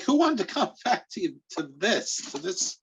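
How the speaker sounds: high-pitched mock disbelief; incredulous retort, starting high then stumbling. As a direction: squeak it out as if baffled. Acted incredulous retort to the coach.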